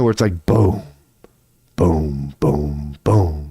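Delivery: leise, tiefe Klopfschläge im Takt; boom-boom wie dumpfes Klopfen durch die Wand; nachgemachtes Wummern. Shown as a demonstration. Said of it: "has a rhythm to the beat".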